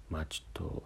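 breathy hushed whisper. Shown as a demonstration. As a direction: whisper it low as if sharing a secret. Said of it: "ささやき声で". (ささやき声で)

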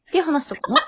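usual speaking voice

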